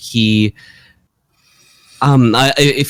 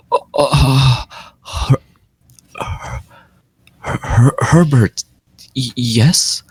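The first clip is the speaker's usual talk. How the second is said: halting, broken-off words, slowly searching; a shaken old man, struggling for the name; stunned and sad; 3 semitones higher, slower than usual. Acted. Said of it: "he looks much sadder".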